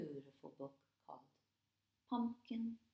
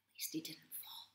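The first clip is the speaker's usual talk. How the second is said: soft whisper; whispered aside; 3 semitones lower, 7 dB quieter, faster than usual. A narrator's reading. Narrator whispering relief to the children.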